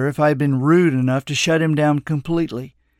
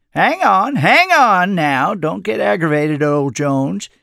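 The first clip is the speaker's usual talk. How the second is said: raised, drawn-out 'hang on', then easing down; chuckle-toned objection, higher at first then settling; mock protest; 4 semitones higher, 4 dB louder than usual. A narrator's reading. Old man playfully calming the annoyed narrator, mock protest. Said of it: "in mock protest".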